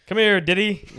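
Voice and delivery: loud call. Acted. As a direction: call him over loudly. [name] calling out.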